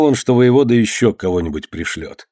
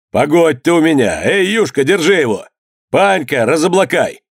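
higher-pitched brisk commands; calling out orders; 6 semitones higher than usual. A narrator's reading. Master ordering servants to seize the governor, calling out. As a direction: summon the servants with raised voice.

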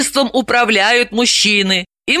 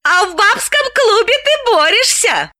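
gloating. A narrator's reading. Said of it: "злорадно напомнила".

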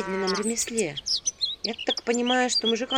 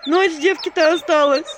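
high, loud, slow wail; loud keening through a door; howling lament; 7 semitones higher, 7 dB louder, slower than usual. A narrator's reading. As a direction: howl out the trouble like a peasant woman. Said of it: "ревели у меня под дверью".

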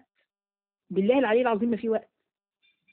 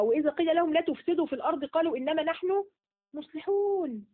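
a touch higher verse recitation; Quran recitation; 5 semitones higher than usual. Acted. Teacher recites the verse for her class, lightly raised. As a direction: recite the verse with gentle lift.

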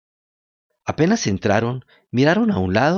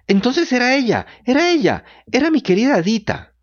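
crying out; 12 semitones higher than usual. Acted. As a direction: wail her name in grief. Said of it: "comenzó a gritar Pinocho, sollozando y llorando".

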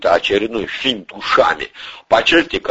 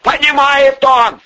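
high, loud, drawn-out yell; slow, high-pitched bellow; outraged yell; 5 dB louder, slower than usual. A narrator's reading. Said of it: "завопил Порешало".

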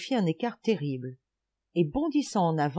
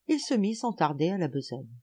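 ordinary delivery, nothing marked

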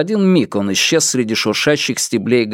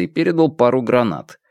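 ordinary delivery

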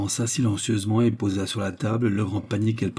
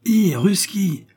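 loud, high-pitched call; yelling out; 10 semitones higher, 5 dB louder, much slower than usual. A narrator's reading. Hungry prisoner at the window calling an unseen Russian, yelling. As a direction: holler to someone out of sight. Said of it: "hurla-t-il en s'adressant".

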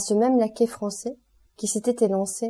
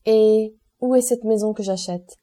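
offhand; 3 semitones higher, 5 dB louder than usual. A narrator's reading. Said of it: "demanda négligemment".

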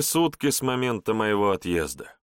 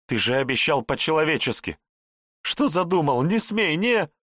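shrill rising panic, higher, louder; angry wailing; 7 semitones higher, 3 dB louder than usual. A narrator's reading. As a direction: scream at him to stop. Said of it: "злобно запричитала". (злобно причитая, переходя на крик)

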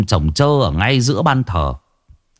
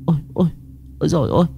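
put-on high, broken frightened gasps of an old woman; stammering in fright; 8 semitones higher, 6 dB quieter, much faster than usual. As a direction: stutter out the terror in soft bursts. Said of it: "Bà lùi lại vài bước ú ớ".

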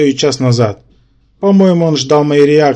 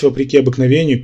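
usual speaking voice

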